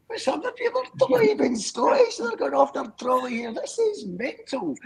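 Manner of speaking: acting out his own amazement at the crowd; retold disbelief. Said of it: "And I was like, like going".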